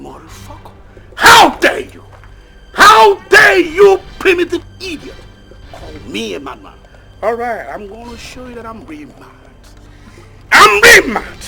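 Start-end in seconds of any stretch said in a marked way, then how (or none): 1.2-5.2 roaring with rage, much louder and higher; raging shout; furious yelling. Acted. Furious man confronts cowering victim. 10.5-11.4 shouted declaration of rage; angry yell. Acted. Furious man confronts cowering victim.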